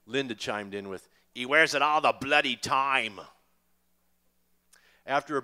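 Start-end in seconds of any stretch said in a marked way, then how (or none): 1.3-3.2 put-on louder, teasing English accent; a wife's playful jab in an English accent. Linda teasing about Paul's t-shirt, louder English accent.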